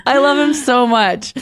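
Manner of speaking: high, laughing and adoring; laughing gush. Real, not acted. Gushing with laughter over the adorable lineman clip. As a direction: giggle it out, swooning over him.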